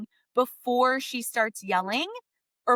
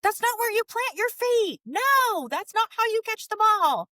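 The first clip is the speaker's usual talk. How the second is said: sharp high shouting coach; coach yelling; 10 semitones higher, 4 dB louder than usual. Retold or imitated. Coach yelling at a kid on the field, loud and high. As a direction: bark corrections at a young player. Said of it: "yelling at you".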